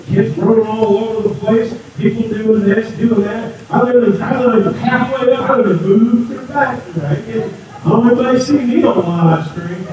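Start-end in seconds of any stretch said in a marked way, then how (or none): none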